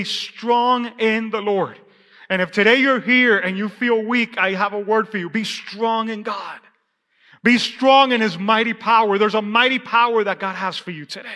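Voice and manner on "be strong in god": slow exhortation. Real, not acted. Preacher exhorting the weak.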